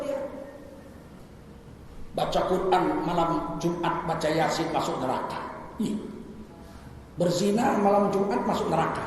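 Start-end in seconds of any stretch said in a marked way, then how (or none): none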